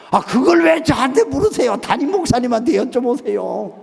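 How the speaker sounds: high-pitched, protesting retort; acted exasperation. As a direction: fend off the caller, acting out a fed-up pastor. Pastor fends off caller, exasperated.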